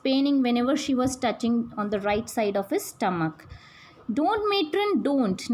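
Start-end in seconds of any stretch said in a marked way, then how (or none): 4.2-5.5 pretend pleading in pain. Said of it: "the boy was acting and saying".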